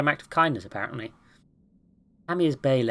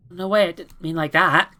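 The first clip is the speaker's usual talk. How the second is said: put-on quick eager kid correcting himself; protesting kid; 5 semitones higher, 5 dB louder than usual. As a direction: kid voice, higher and louder. Cal protests.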